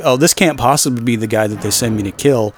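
ordinary delivery

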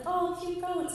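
high-pitched cooing passerby; admiring passerby. Retold or imitated. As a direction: imitate a delighted stranger. Passing couple admire the goats.

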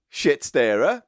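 normal talking voice

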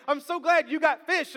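high, loud put-on delight; hammed-up enthusiasm; mock gladness. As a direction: gush it as if hugely relieved. Acting out Peter's reply to Jesus.

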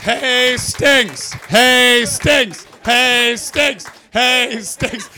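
loud repeated call with laughter. Real, not acted. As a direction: call his name across the room. Calling out his name.

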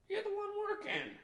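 very high squeaky-pitched punchline; mock exclamation. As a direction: jump way up in pitch for a mocking ruling. Acts court ruling, punchline.

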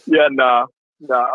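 ordinary talk

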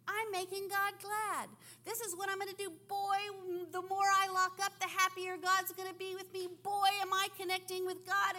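high, loud, proud inner voice; lightly voiced zealous Saul; Saul's thoughts. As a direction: gloat as a self-satisfied Saul.

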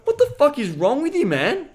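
high, loud retold disbelief; exasperated outburst. Acts out exasperated reply to friend.

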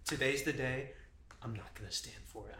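low, hushed pep talk to oneself; quiet mock resolve. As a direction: psych yourself up under your breath. Joins in as singer at mirror.

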